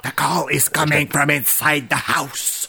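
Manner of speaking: slow, ominous movie-trailer delivery; lightly voiced film villain line, drawn out; movie line quote. Quoting the film line to his co-host, slow and ominous.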